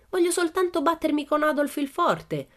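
put-on very high girlish voice; high-pitched Pippi-like child; little girl. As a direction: protest innocently in a high child's voice. Little girl pleads with director.

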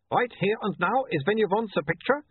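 put-on anxious, light Uberwaldian accent; pressing the point, a hint of Uberwaldian; nervous Uberwaldian photographer, lightly voiced. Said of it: "don't make fun of his accent".

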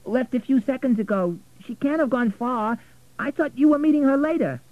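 high-pitched, insistent reply; worried informant. Acted. Telling Richard she's already gone, high and insistent. Voices a character speaking to Richard.

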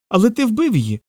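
high-pitched squirrel accusation; distressed small-creature voice; upset squirrel. Acted. Squirrel accuses Peter of killing.

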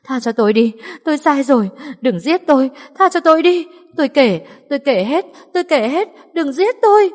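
high, loud panicked pleading; terrified begging. Acted. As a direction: beg for your life, voice climbing. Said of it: "sợ hãi dập đầu lia lịa về phía trước van xin".

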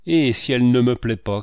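loud, defiant cried question; shouting. A narrator's reading. Landlord retorts to praise of the fresco, shouting. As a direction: throw the challenge out loudly. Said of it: "cria Lavoine".

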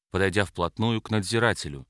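ordinary delivery, nothing marked

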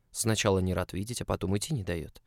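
ordinary delivery, nothing marked

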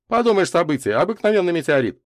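high, loud and dismissive; dismissive interruption. A narrator's reading. Славка interrupts the adults about the meteorite, dismissive. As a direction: butt in to brush it off. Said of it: "перебил Славка".

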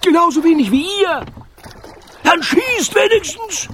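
very high, loud, strained retort; enraged pirate captain. A narrator's reading. Pirate captain orders crew to fire, furious yell.

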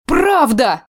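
high, glad exclamation; light up with joy; happy old woman; delighted. A narrator's reading. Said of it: "Обрадовалась Алевтина".

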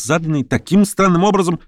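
insistent, disputing tone; argumentative accusation. Acted. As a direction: press the objection about "странным образом". Lame man challenging Verkhovensky.

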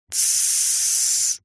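long, soft "tsss" shush; hissed shush. A narrator's reading. Narrator shushes the crew, махнув рукой, with a drawn-out hiss. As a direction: hiss for silence. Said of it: "зашипел я".

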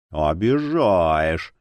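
low, very slow drawl; long-drawn mock-hurt protest. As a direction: stretch the vowels right out, pitched low. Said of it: "протянул Лоуби".

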